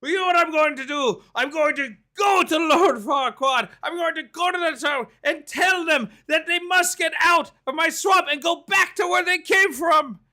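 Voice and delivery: hammed-up ogre yelling a plan; Shrek shouting. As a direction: announce it in a booming put-on voice. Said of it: "He's like".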